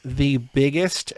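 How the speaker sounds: slow, drawn-out words; slow, measured build-up to the key word; very specific question. Real, not acted. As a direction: stretch it out deliberately. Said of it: "asking it in a very specific way".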